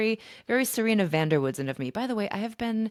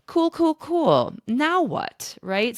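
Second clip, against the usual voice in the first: slow, much higher, louder mock calm; sarcastic cool; 9 semitones higher, 5 dB louder, much slower than usual. Traveler facing her stolen bag, slow sarcastic calm. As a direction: pretend it's fine when it isn't.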